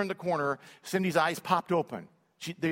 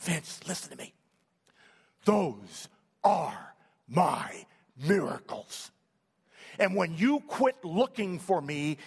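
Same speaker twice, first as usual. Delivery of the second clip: slow tender voice of God; slower than usual. Retold or imitated. God gently answers Vince, slow and tender. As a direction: let each word land, tender and unhurried.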